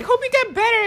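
high-voiced joking imitation; Donald Trump. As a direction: do a quick, high mock politician line. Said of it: "Donald Trump being like".

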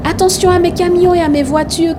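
high, loud warning cry; shouting a warning. Acted. Boy shouting to his dad about his toys, loud cry. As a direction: yell to stop the car in time. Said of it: "cria Thomas".

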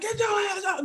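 high-pitched cussing without volume; high, soft mock outburst. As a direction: cuss them out high but softly. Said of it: "you better not be like".

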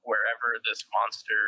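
normal talking voice

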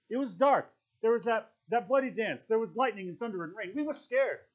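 high, agitated outburst; frantic excuse. A narrator's reading. Piggy defends the killing to Ralph, frantic. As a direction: plead the excuse at high pitch.

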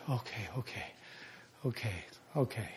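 very soft, low repeated okays; resigned surrender. Retold or imitated. Pastor giving in to God, soft and low. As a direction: let go, barely audible.

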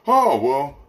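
loud, high mock "oh, well"; men acquiescing. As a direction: act out a man going along with it. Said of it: "men just acquiesce to that".

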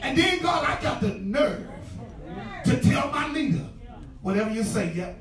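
loud, rising sermon cadence with organ behind; full-force preaching. Acted. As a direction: preach it out to the church, pressing every phrase. Pastor preaching to congregation.

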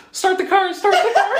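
high, loud, laughing urgency; urgent getaway plea. Retold or imitated. Teller urging wife to floor it at the border, high and loud. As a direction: hurry her up, half laughing.

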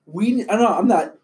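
ordinary delivery

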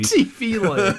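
lightly put-on old-time radio voice. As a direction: say it like a vintage radio host. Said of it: "like an Audrey Hepburn thing".

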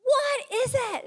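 high, drawn mock-exasperated call; exasperated mum. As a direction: answer back as a mum losing patience. Exasperated mum answers child again.

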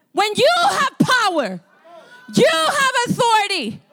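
high, impassioned exhortation; fired-up preaching. Real, not acted. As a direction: drive it home to the congregation. Preaching at full force.

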